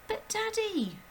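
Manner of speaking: put-on high pleading child's voice; high, pleading little girl; begging girl. A narrator's reading. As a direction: plead with her father. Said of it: "Matilda begged".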